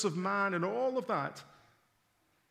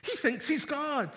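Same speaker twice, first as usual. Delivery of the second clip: indignant Pharisees; 6 semitones higher than usual. Retold or imitated. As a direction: say it like a scandalised Pharisee. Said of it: "would be saying to one another".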